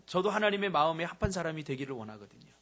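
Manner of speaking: usual speaking voice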